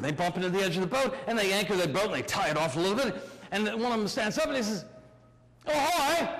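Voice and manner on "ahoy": higher-pitched drawn-out hail; hailing call. Acted. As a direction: call up to the ship's deck. Pirate hails the big boat.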